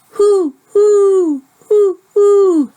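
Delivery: high, loud hooting owl call. Shown as a demonstration. Teacher hooting like an owl for kids. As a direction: hoot like an owl for the class. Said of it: "makes a sound like this".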